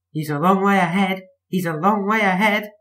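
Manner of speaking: high wailing coaches. A narrator's reading. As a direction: wail it out in distress. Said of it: "they wailed".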